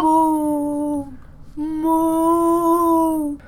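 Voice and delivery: slow mooing cow. Pretend. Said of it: "That's your best impression of a cow?".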